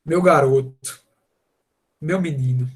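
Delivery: cradle the words like a loving dad; tender father. Retold or imitated. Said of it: "é como se fosse assim". (pai carinhoso com o filho)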